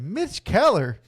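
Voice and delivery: mock disbelief. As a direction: act shocked at the name. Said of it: "He said".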